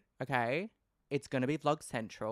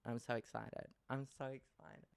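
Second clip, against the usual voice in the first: quiet excited; 9 dB quieter than usual. Real, not acted.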